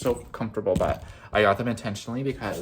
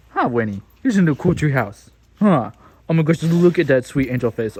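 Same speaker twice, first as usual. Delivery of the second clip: dog voice; 3 semitones higher, 11 dB louder than usual. Real, not acted. Talking to dog Winnie.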